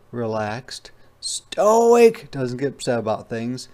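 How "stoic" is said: high, slow, drawn-out sloth voice; unhurried sloth. Acted. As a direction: talk like a calm sloth in no hurry, much higher. Sloth voiced in read story.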